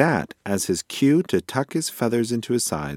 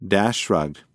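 ordinary delivery

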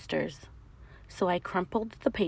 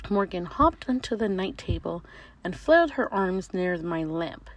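ordinary delivery

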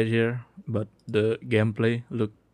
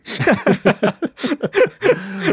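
high-pitched laugh; 4 dB louder than usual. Real, not acted.

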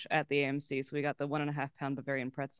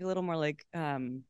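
ordinary talk

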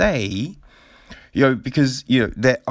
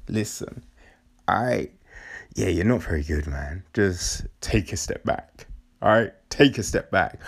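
gentle honest advice; 6 semitones lower than usual. Retold or imitated. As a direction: let them down gently. Voicing a gentle honest mentor.

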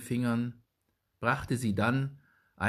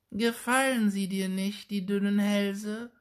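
put-on high, sly old hag voice; muttering witch; 11 semitones higher than usual. A narrator's reading. Old witch slyly asking the boy about thin necks, high hag voice. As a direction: needle the boy like a crone. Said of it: "murmelte die Alte".

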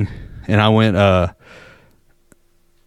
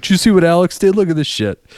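excited dad; 7 semitones higher, faster than usual. Retold or imitated. Dad showing off the video.

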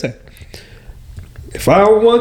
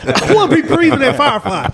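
high-pitched comic tantrum; mock whining; 8 semitones higher than usual. Worker missing Firefly festival, mock whining. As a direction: whine it high, close to laughing.

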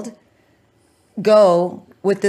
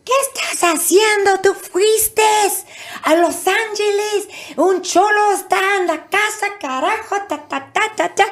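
scolding mom in Spanish; 13 semitones higher than usual. Retold or imitated. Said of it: "She goes".

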